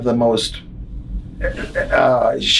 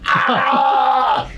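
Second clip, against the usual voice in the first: high, drawn-out scream of pain; 12 semitones higher than usual. Retold or imitated. Wrestler jabbed from behind by a pencil, screaming. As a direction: imitate a sudden agonized scream. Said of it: "He starts screaming".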